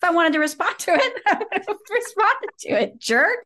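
high-pitched, exasperated comeback; mock indignant retort. User talking back to Google's email reminder, mock indignant. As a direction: snap back in comic outrage, half laughing.

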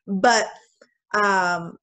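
usual speaking voice

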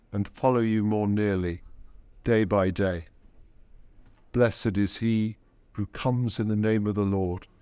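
ordinary talk

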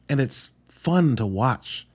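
usual speaking voice

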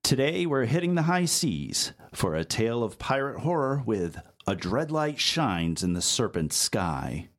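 ordinary talk

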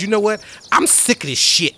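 angry rant. Acted. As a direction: slam the book and vent at everyone. Melage slams compendium, ranting.

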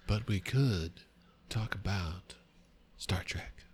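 halting Kirk voice dropping to a whisper, with long dramatic pauses; a hushed, broken-up Captain Kirk; terrible first-try Kirk, trailing into breathy whispers; Captain Kirk impression. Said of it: "this is your Captain Kirk".

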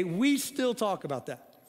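ordinary talk

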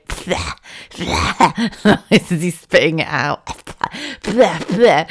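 put-on spluttery bleh bleh of a toddler spitting sponge; imitated spitting. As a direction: spit it out like a baby. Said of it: "he was like, going".

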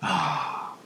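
a long soft sigh of longing; wistful sigh. Real, not acted. Host missing Spain to guest, soft wistful sigh. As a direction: sigh as if missing a sunny place. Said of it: "You see, I'm sighing now".

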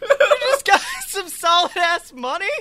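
high, loud, cracking up; incredulous squeal with laughs breaking in; laughing disbelief. Real, not acted. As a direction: shout it through real laughter. Astonished, laughing at the story.

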